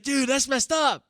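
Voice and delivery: high, slow, drawn-out disgust; appalled inmate. Retold or imitated. Inmate scolding the pastor, high and slow. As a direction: stretch it out, scandalized. Inmate repeats his reaction.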